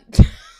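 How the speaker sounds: a sudden very loud real laugh; loud burst of laughter. Real, not acted. Streamer cracking up telling chat the Superdrug story, loud laugh. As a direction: explode into laughter.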